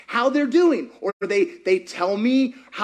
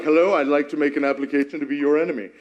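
mock formal request; 9 semitones lower, much faster than usual. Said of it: "You're like".

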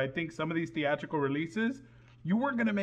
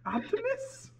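a hammed-up squeaky call; mock call to Optimus; 9 semitones higher than usual. Host parodies calling out to Optimus, squeaky mock voice.

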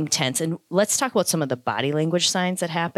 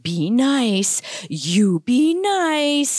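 rising to a very high, slow singsong warning; high nagging owner; 9 semitones higher, 4 dB louder, much slower than usual. Retold or imitated. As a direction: mimic a nervous owner going up and up. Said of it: "as soon as they take that higher tone".

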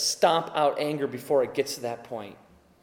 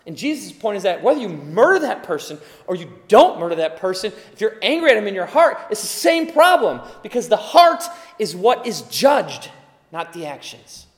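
loud, raised preaching voice; fervent preaching; 4 semitones higher, 8 dB louder than usual. Acted. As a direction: preach it hard to the congregation. Preaching at full force.

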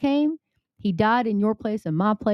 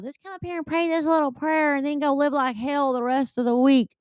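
sarcastic, higher mock sermon voice; mocking preacher quote; 6 semitones higher than usual. Preacher mocking easy-prayer churches with a sarcastic high voice. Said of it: "They're all about".

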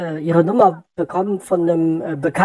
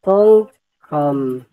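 letter by letter, slow; slow spelling; much slower than usual. Real, not acted. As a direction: spell it out carefully, checking. Spells address back slowly. (langsam buchstabierend)

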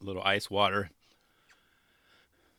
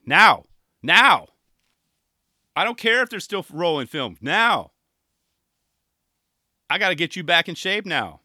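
loud barked orders, higher; drill sergeant shout; 5 semitones higher, 8 dB louder than usual. Retold or imitated. Mom yelling at her son to drop and do twenty, loud bark.

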